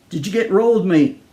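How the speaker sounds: put-on a touch of Australian accent, calling out; lightly voiced Aussie stranger calling to a man. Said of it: "A tall Australian called out".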